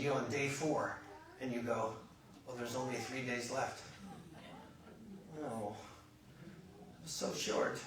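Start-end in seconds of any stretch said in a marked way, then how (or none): 2.5-7.8 subdued acted disappointment; deflated realization. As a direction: let the holiday mood sink. Vacationer realizing time is running out.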